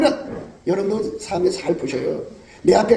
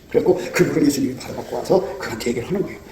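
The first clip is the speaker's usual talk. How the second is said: ordinary talk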